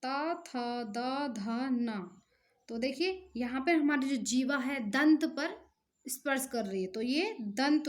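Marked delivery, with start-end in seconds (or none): 0.0-2.2 ट, ठ, ड spoken very slowly one by one; slow, careful teacher recitation; slow letter demo. Shown as a demonstration. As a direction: spell out each sound for the class. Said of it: "अब आगे देखिए".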